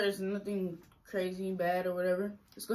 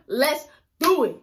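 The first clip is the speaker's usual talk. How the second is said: excited; 9 semitones higher, 8 dB louder than usual. Real, not acted. Hyping up friend's channel.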